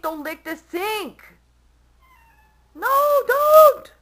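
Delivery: slow scolding building to a high shriek; yell. Real, not acted. Scolding cat licking sink.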